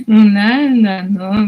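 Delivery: slow, loud put-upon sigh of a question; drawn-out, fed-up complaint to the crying cat; tired owner asking the cat what it wants; exasperated. Retold or imitated. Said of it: "みたいな感じ".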